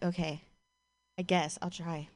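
low, quiet, hesitant reply; reluctant agreement. Acted. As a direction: give in half-heartedly, dropping the voice.

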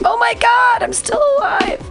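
normal talking voice

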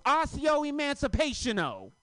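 high, mock wizard incantation; lightly voiced kid chanting a spell; spell casting. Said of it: "He goes".

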